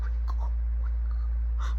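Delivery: hushed whisper. Retold or imitated. Brother whispering while hiding, hushed.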